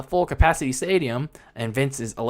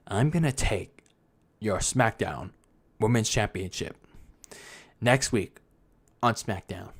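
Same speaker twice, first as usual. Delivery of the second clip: low, soft, halting monotone mock promo; mock robotic threat, dropped low and quiet; robotic Sasha; 5 semitones lower, 8 dB quieter than usual. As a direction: imitate a lifeless promo, pausing between phrases. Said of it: "Why did she sound so robotic?".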